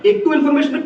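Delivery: ordinary delivery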